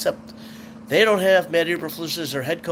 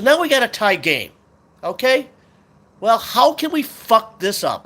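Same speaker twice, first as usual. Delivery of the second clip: pissed; 7 semitones higher, 5 dB louder than usual. Real, not acted. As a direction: sneer it out at your own team. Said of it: "but I'm pissed".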